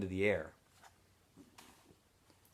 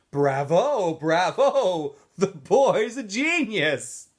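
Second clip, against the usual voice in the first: sarcastic cheer; 9 semitones higher, 10 dB louder, much slower than usual. A narrator's reading. Sarcastic clapping counselor.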